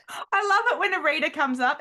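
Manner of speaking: high-pitched sarcastic gushing. Shown as a demonstration. Author on readers who criticise her in person, sarcastic. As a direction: gush with obvious fake delight. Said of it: "I'm being sarcastic here".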